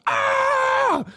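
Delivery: a high, sudden mock cry of pain; retold scream of pain. Ex-girlfriend rolls over in bed and cries out, high mock yelp. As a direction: yelp like a nerve just pinched. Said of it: "roll over and be like".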